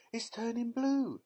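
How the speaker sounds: screamed. A narrator's reading. Mother seeing daughter's nose change, scream. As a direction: scream in horror. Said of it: "screamed Missus Beauregard".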